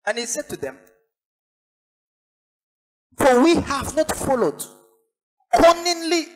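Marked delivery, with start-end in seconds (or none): 3.2-6.3 high-pitched, insistent sermon voice; fervent preacher hammering each phrase; emphatic preaching. Acted. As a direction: declare the scripture with urgent conviction. Preaches the scripture reading emphatically.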